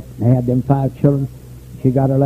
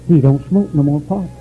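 ordinary talk, nothing marked

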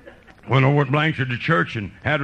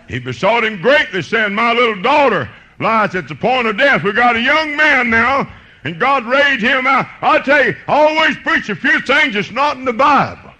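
loud, high, driving sermon cadence; full-force preaching; 13 semitones higher, 8 dB louder than usual. Real, not acted. Preacher proclaiming Jairus' plea to the congregation, loud and high. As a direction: belt out the scripture in hard-hit phrases.

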